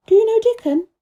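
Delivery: put-on a child suddenly keen; eager Mary. A narrator's reading. Mary asking about Dickon, eager and high. Mary's voice.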